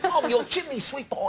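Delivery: high-pitched chimney sweep in fake Cockney; bad Mary Poppins Cockney. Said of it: "Mary Poppins kind of".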